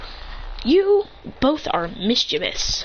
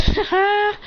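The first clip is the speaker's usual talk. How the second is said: high, short "ha ha"; acted laugh; 12 semitones higher than usual. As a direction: toss out a put-on laugh. Character laughs on returning.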